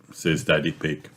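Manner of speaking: ordinary delivery, nothing marked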